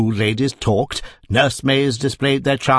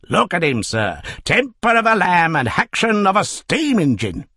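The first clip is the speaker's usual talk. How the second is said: cab driver; 7 semitones higher than usual. Acted. Cab driver praising his horse.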